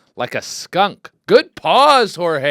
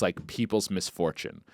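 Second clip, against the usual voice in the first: ordinary delivery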